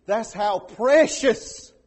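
a hint of a creature in a drawn-out "precious"; accidental Gollum-ish. Shown as a demonstration. Pastor stretching "precious" in a sermon, slightly creature-like. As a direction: slip into a little creature voice for a moment. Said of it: "I sounded like the little thing on".

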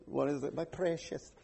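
a lightly done creepy creature murmur; creature impression. Pastor joking he sounded like the little creature, lightly voiced. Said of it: "I sounded like that little cat there".

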